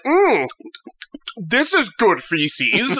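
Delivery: slow, dopey savoring of a meal; drawn-out mock bliss; contented maggot. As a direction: relish it slowly, as a simple grub would. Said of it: "You just care about".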